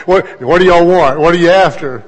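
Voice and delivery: a lightly voiced wary dad; teasing suspicion at children buttering him up; mock suspicious question. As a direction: probe the kids, half amused. Father questions kids' sudden flattery.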